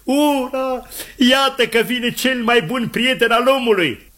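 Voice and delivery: high, hammed-up hurrah; joyful exclamation. Scott sees the rescue dog approaching, exclaims high and delighted. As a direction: cry it out high with relief and delight. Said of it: "Scott exclamă".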